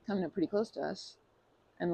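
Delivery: usual speaking voice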